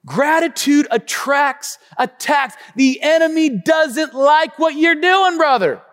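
high, loud, slow preaching. Acted. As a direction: drive it home to the church. Preaching at full force.

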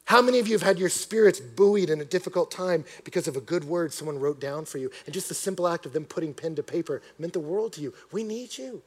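usual speaking voice

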